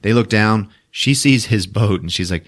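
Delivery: normal talking voice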